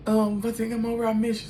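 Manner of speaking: high, sugary mock-tender voice; mock sweet drunk text. Mocking what the sister never says, high sappy coo. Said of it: "she not saying stuff like".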